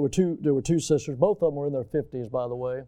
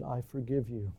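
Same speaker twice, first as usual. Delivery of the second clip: quiet forgiveness; 3 semitones lower, 8 dB quieter than usual. Retold or imitated. Forgiving the soldier, hushed.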